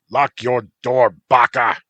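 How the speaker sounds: loud sharp shout from a window. Acted. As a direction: yell it out the car window. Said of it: "he shouted".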